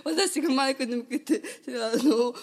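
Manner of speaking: real laughter through the words; laughing into the mic. Real, not acted. Host laughing at her guest's answer, talking through giggles. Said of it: "笑っておりますので".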